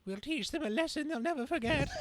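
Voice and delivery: high, fast sneering villain voice; cartoon villain gloat. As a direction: gloat over the victims in a high quick sneer.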